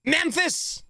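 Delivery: high-pitched blurted guess; excited shout. Real, not acted. Streamer guessing where the accent's from, excited shout. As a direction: call it out like a sudden realization.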